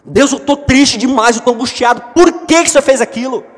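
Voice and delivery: high, loud lament to God; angry anguished prayer. Acted. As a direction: hurl the questions at God in grief. Acted angry sad prayer. (lamento irado a Deus, alto e agudo)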